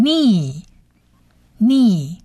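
"ni" drawn out as a long nee; slow "ni", rising then falling; long vowel demo. Shown as a demonstration. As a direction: hold the ee in "ni" for learners. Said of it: "这个长母音e".

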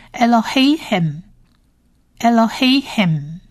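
"Elohim" read slowly; whole word demo. Shown as a demonstration. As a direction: put the syllables together into "Elohim". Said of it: "整体发音就是Elohim". (完整慢读Elohim)

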